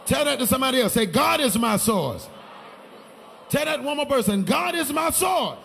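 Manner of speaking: loud preacher building to a shout; loud, emphatic call-and-response preaching; full-force preaching. Real, not acted. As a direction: rouse the congregation to declare it. Preaches, leads congregation in declaration.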